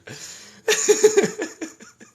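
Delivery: long loud cackle way up high; loud high laugh. Real, not acted. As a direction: let a big laugh rip. Laughing after good lord.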